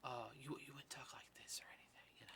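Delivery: a hint of a hushed Godfather rasp; Al Pacino Godfather voice. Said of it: "You're not Al Pacino in The Godfather".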